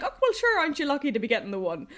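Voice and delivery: high start then fast, shrugging rural Irish lilt; breezy rural Irish attitude. Retold or imitated. Shopkeeper tells her she's lucky to get one, light rural Irish brush-off. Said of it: "his attitude was like".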